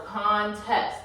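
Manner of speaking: "contest" slowly broken into con, then test; "contest" sounded out slowly in two beats; split by syllables. Shown as a demonstration.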